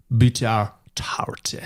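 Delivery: butter tarte in a breathy Quebec French lilt; French Canadian accent. Pretend. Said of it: "your best French Canadian accent there".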